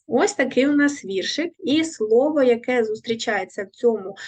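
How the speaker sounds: ordinary talk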